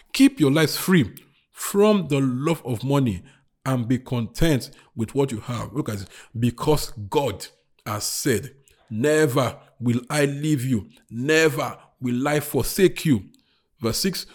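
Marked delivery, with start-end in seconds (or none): 0.1-5.7 loud, slow, emphatic Bible reading; scripture proclamation. Real, not acted. Preacher reads scripture aloud. 6.4-13.2 loud, slow, emphatic Bible reading; scripture proclamation. Real, not acted. Preacher reads scripture aloud.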